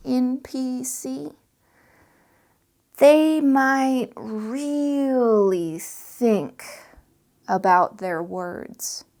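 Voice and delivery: very slow, drawn out with long pauses; unhurried, thinking over each word; slow laid-back talk. Shown as a demonstration. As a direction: take your time like a laid-back NPC. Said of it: "You see the difference ... or slowly I talk".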